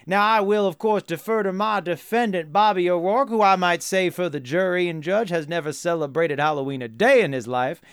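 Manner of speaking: hammed-up Southern country lawyer; bad Southern accent. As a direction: plead to the jury in a fake Southern accent. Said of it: "please stop doing a bad Southern accent".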